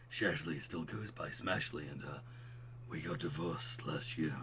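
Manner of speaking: low, subdued dude voice; smooth, buttery bro. Acted. Craig telling an old friend of divorce, smooth low voice. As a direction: break it low and softly.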